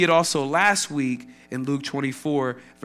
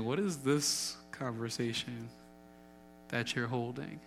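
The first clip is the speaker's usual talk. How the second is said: gentle questioning; 3 semitones lower, 13 dB quieter than usual. Retold or imitated. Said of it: "I can imagine Jesus saying".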